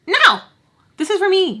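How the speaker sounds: high, loud, possessive refusal; emphatic refusal. Retold or imitated. Refusing the girls her needle, high and emphatic. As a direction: shoot it down loudly, pitched right up.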